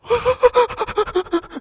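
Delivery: high, loud boo-hoo wail; wailing sob. Shown as a demonstration. Showing how Aunt Wanda cries, high wail.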